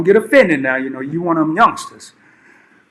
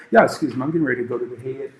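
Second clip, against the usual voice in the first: polite excuse; 4 semitones lower, 7 dB quieter, much faster than usual. Retold or imitated. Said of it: "I get up".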